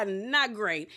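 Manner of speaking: repeats it through a real laugh; laughing as she echoes the line. Real, not acted.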